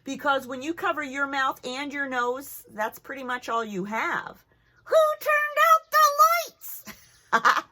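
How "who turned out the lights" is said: high, loud and slow puppet; a puppet suddenly blinded, calling out. Acted. Puppet with mask over eyes.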